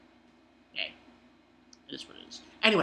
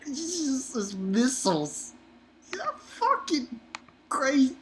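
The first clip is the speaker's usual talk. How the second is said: much higher, slowed, amazed; excited; 10 semitones higher, slower than usual. Real, not acted. Reviewer hyped about the missile volley.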